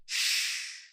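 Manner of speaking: "Shh." hissed with no voice; shushing sheen. Shown as a demonstration. Teacher recaps the letter sheen. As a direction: hush like a sheen. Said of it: "I have seen three boys and said".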